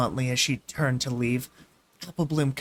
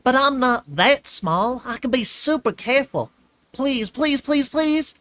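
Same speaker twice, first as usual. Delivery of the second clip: filly begging; 9 semitones higher, 6 dB louder than usual. A narrator's reading. Filly pleading with Twilight to come, begging. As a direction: beg like a little sister.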